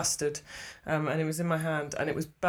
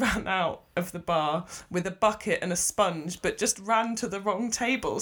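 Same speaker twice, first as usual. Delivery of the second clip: normal talking voice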